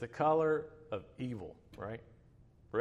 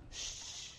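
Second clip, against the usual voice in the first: soft breathy shush; imitated soothing shush; retold shushing; 16 dB quieter than usual. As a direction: hush her with a quiet "shh". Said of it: "so I'm like".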